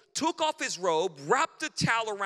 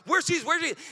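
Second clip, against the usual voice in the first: loud, high eager asking; excited dignitaries calling; 8 semitones higher, 6 dB louder, faster than usual. Retold or imitated. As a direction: call out hunting for him.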